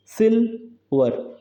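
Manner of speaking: Silver slowly split, सिल held, वर falling; stressed first syllable. Shown as a demonstration. Teacher showing a trochee to students, silver stressed on सिल. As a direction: stretch सिल in silver, pause before वर. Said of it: "ये stress level है और ये unstress level है".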